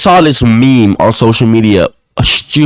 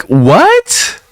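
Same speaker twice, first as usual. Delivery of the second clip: high incredulous what; a surprised high what; mock disbelief; 12 semitones higher than usual. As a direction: squeak out your disbelief. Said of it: "I'm like".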